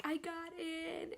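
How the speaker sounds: high, soft, drawn-out near-sob; mock-weepy, slow and small; retold tearful news. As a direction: imitate being about to burst into tears. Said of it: "I just started bawling".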